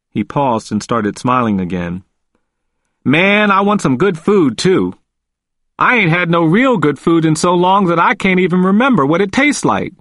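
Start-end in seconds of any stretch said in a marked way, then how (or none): 3.0-9.9 a freed man's voice, higher and eager; Walter, eager. A narrator's reading. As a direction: Walter planning his future, lifted.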